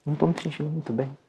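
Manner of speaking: soft, hurried plea for water; speaking low. Shown as a demonstration. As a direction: drop your voice so the waiter has to lean in. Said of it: "você fala com a pessoa assim".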